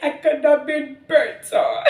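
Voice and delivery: high, slow, half-laughing lament; crying out to God, high and slow; mock weeping. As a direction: retell a tearful confession to God, half-laughing. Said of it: "I remember weeping, crying".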